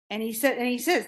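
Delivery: normal talking voice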